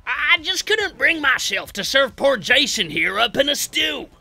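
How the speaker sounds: high, loud, drawn-out cannibal banter; comic-book character voices. Acted. Cannibals bickering over serving Jason as stew, exaggerated character voices. Voicing Cook's and Hitchhiker's quoted dialogue.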